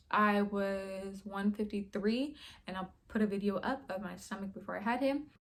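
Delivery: ordinary delivery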